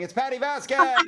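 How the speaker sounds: high, loud name announcement; big show-host build on the name; emcee introduction. Real, not acted. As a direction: bring the next act on with a rising holler. Host announces the next comedian.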